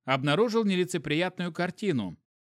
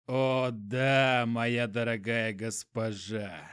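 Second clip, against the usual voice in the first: low, slow dreamy moan; moaning in sleep; 4 semitones lower, much slower than usual. A narrator's reading. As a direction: groan it out half asleep. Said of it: "простонал он сквозь сон".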